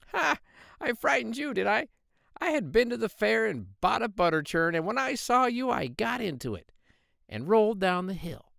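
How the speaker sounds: high, triumphant little pig voice; gloating pig. Acted. Little pig gloating to the wolf about the churn, high and triumphant. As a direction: crow over the wolf from safety.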